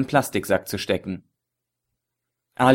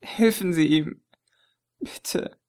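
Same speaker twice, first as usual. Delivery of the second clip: broken plea; 7 semitones higher, 3 dB quieter, slower than usual. A narrator's reading. Chess fleht leise.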